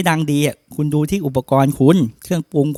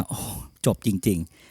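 awed sigh; 7 semitones lower, 9 dB quieter, much slower than usual. Retold or imitated. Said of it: "แล้วคุณจะบอกว่า".

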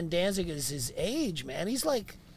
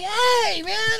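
high, loud rocker drawl; party-rock frontman greeting; 6 dB louder than usual. Retold or imitated. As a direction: ham up the hair-metal singer. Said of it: "he's like".

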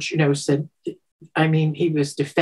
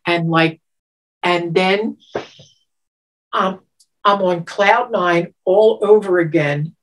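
ordinary delivery

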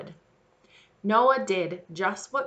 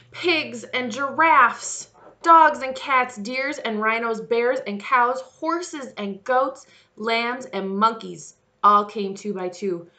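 lively, louder storybook reading; storyteller voice for little ones; children's story narration; 4 semitones higher, 6 dB louder, slower than usual. Acted. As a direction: read it out brightly to the kids. Reads Bible story to children.

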